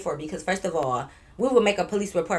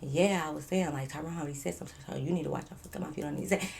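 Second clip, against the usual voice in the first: much softer, fast and low; a quick, quiet, lowered warning; hushed warning; 4 semitones lower, 11 dB quieter, faster than usual. Retold or imitated. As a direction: mutter the warning under your breath.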